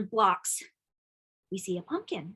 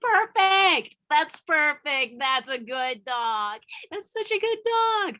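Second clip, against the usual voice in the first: praising the dog; 4 semitones higher, 4 dB louder, slower than usual. Real, not acted.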